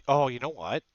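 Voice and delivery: ordinary talk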